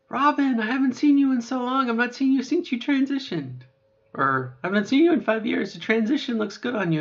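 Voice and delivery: high, loud, gushing greeting; old acquaintance. Pretend. Old friend greeting Robin after years, gushing high and loud. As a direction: gush like a friend who hasn't seen you. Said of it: "it'd be like".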